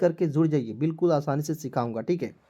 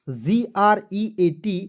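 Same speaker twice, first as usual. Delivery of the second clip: slow spelling; much slower than usual. Shown as a demonstration. Teacher spells out the word.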